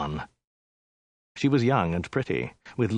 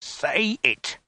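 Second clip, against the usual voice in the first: higher, insistent soldier's command; forceful, drawn-out prompting; threatening order; 6 semitones higher than usual. A narrator's reading. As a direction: demand it with menace. Soldier threatens woman with sword.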